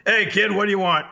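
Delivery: lightly voiced club owner calling to a kid; brusque club owner. Club owner opens back door to knocking kid, brusque.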